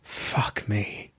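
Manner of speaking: hoarse, breathy whisper; stunned under-the-breath curse; dry-throated whisper. A narrator's reading. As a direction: barely get the words out. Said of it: "found his voice".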